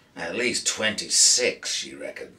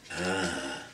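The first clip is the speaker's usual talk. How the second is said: somber. A narrator's reading. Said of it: "Jake said somberly".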